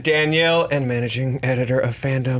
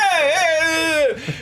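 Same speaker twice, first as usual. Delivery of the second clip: gruff New Yorker; 12 semitones higher, much slower than usual. Retold or imitated. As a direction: bark it like a tough New Yorker. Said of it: "like a really gruff New Yorker".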